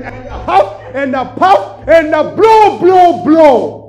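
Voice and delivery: big storybook fox bellow; huffing fox shout. Acted. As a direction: blow the house down, climbing each line. Fox blows at brick house.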